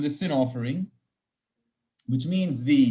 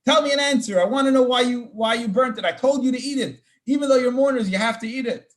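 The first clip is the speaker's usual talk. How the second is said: higher, louder scolding demand; retold screaming; 7 semitones higher, 4 dB louder than usual. Moses screaming at Aaron's remaining sons, loud higher scolding. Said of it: "he starts screaming at them".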